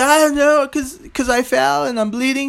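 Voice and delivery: high-pitched whining child imitation; upset little kid. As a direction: plead like a kid in tears. Himself as crying hurt child.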